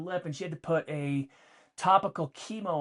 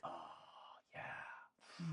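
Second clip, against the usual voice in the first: soft murmur; 13 semitones lower, 22 dB quieter than usual. Retold or imitated. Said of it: "And he's like".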